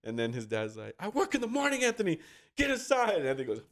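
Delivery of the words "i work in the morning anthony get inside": high-pitched, exasperated imitation of a parent; retold fatherly telling-off; scolding dad. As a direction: order the son in, pitched way up. Voicing angry dad scolding son.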